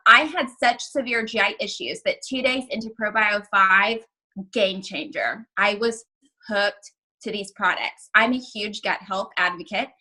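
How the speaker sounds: usual speaking voice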